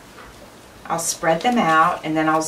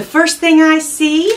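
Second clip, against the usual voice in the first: high, loud announcement of the first find; light up at the first item; excited reveal; 9 semitones higher, 8 dB louder than usual. Real, not acted. Vlogger opening the box to viewers, high and excited.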